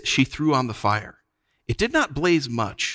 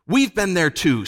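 higher-pitched cross retort; angry snap; 7 semitones higher than usual. A narrator's reading. Jill fighting the enchantment, crossly snapping. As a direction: snap back in mild anger. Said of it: "snapped Jill. She was very angry".

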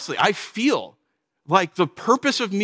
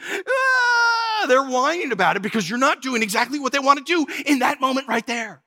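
high, whiny crying voice, lightly done; whining child; 8 semitones higher than usual. Retold or imitated. Preacher mimics a child whining at Legoland, high and whiny. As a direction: whimper it like a bored kid at Legoland.

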